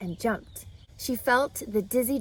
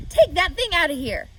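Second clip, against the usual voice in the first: loud, high scolding order; 8 semitones higher, 9 dB louder than usual. Acted. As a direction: bark it at the children. Farm wife orders frog out.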